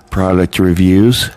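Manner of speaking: drawn out through a yawn. Real, not acted. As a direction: say it mid-yawn. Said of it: "I know I keep yawning".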